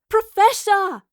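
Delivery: high loud cry; cried out. Acted. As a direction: cry out to get her attention. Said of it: "Astrid cried".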